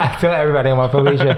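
laughing while repeating it. Real, not acted.

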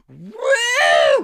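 shrieked high woo, arms up; mock party woo. Comic imitating a heckler's piercing woo. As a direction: imitate the drunk lawyer's woo. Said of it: "She goes".

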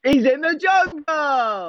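high, slow exclaimed line; mock surprise. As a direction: stretch it out in mock shock. [name] plays along with the joke.

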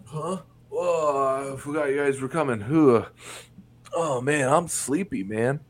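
half-asleep guy caught off guard; groggy just-woken dad. Retold or imitated. Friends arrive, dad barely awake, groggy mumble. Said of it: "And he like".

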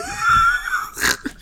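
helpless high laugh; high shrieking laugh. Real, not acted. Cracks up mid sentence.

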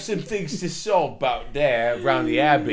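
hammed-up English accent with laughter; fake English accent. Hustler sweet-talking women, in hammed-up English accent. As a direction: lay the English accent on thick. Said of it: "My fake English accent?".

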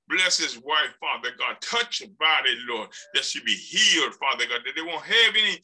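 earnest healing prayer; urgent prayer over the sick. Real, not acted. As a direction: plead for her body to heal. Prays for healing.

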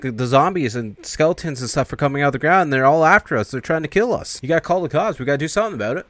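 acted alarm, building in pitch and volume; panicked report. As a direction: blurt it out as a mock-panicked alarm. Acts teens pleading about zombies.

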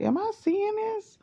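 retold disbelief. Retold shock at kitten on highway.

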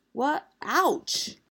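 high, startled yelp; startled cry. Acted. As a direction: yelp as the flour hits. Hit by falling flour.

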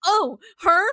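high, loud, incredulous tirade; angry rant. Real, not acted. Host scolding Gideon over the screens line, angry rant. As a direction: yell mocking questions in outrage. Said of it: "I'm angry".

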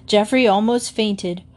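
usual speaking voice

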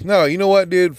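ordinary talk

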